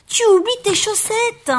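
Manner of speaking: put-on high, loud childlike shout; Baby Bear calling out. A narrator's reading. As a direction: call after someone in a squeaky bear voice. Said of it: "lui cria bébé ours". (bébé ours crie, voix très aiguë)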